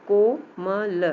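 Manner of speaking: कोमल split into three beats, ko, ma, la; syllable by syllable. Shown as a demonstration. Teacher counts the three letters for children, word split syllable by syllable. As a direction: separate each letter of कोमल evenly. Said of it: "अक्षर इसमें तीन ही हैं".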